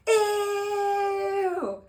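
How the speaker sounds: long, high-pitched squeal of disgust; exaggerated mock revulsion; hammed-up disgust. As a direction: draw it out like a grossed-out kid. Acts out disgust at the dance.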